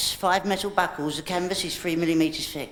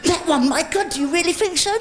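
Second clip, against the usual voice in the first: much higher, astonished gasp; mock amazement; 10 semitones higher than usual. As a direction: gush in hammy disbelief.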